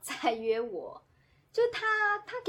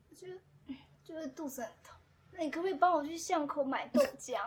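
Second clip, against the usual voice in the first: weak fake-sick voice; 6 semitones higher than usual. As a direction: pretend to be ill to send dad away. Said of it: "很虚弱的声音". (装出虚弱的声音求爸爸)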